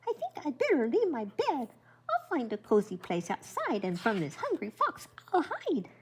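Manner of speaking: grandma voicing the scared hare planning to hide; quaking hare. A narrator's reading. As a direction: fret it out like a trembling little hare. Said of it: "Hare was quaking".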